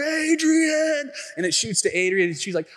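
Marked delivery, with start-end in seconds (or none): 0.0-1.1 high, loud drawn-out bellow; hammed-up film hero cry; Rocky. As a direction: call out for her across the crowd. Imitates Rocky calling out.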